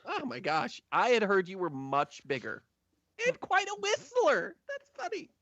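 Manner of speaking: high-pitched, amazed gushing; starstruck admin. Acted. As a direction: marvel at a celebrity in a high voice.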